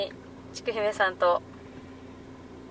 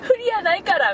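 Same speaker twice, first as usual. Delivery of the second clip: high, laughing protest; laughing denial; 8 semitones higher than usual. Retold or imitated. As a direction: protest while cracking up. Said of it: "みたいな".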